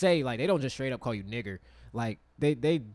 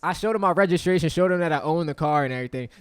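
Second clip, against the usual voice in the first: ordinary talk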